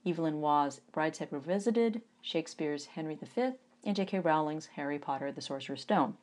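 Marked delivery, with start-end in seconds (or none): none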